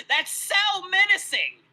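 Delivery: slow, high, loud mock awe; loud sarcasm. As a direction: drag it out sarcastically. Sarcastic jab in heated argument.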